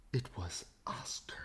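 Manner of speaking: hushed reveal; dramatic whisper. A narrator's reading. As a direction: whisper the hero's name.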